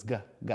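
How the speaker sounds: "g, g" as a repeated hard g sound. Shown as a demonstration. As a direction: say "g, g" as two short separate g sounds. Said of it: "I'm stretching out those words".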